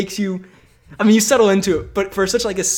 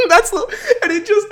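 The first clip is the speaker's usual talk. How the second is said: high, squeaky laughing speech; helpless laughing; 6 dB louder than usual. Real, not acted. Host cracking up at the movie scene, helpless laughing. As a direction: barely get it out for laughing.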